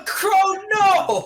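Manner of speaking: high, drawn-out cry of disbelief; hammed-up shock; mock dismay. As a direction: wail it through a laugh. Reacts to mock death announcement.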